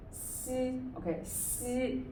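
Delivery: 西 said softly as a clear "see" with a narrow s; pinyin x sound. Shown as a demonstration. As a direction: model 西 as a soft, careful "see". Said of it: "the X is pronounced as".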